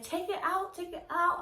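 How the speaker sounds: high desperate pleading, pitch way up; half-performed panicked begging; retold scream. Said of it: "I was screaming".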